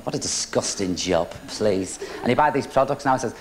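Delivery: ordinary delivery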